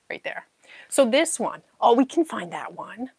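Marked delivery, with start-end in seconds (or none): 0.1-0.5 whisper. Real, not acted. Spotting piece.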